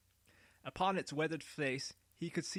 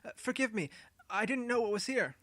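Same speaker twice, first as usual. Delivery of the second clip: higher, nervous pleading; fearful; 7 semitones higher than usual. A narrator's reading. Boy to Noah after being caught, fearful pleading. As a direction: beg forgiveness, scared of punishment. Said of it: "fearful of some punishment".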